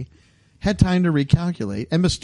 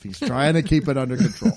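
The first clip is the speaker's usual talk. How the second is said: ordinary talk, nothing marked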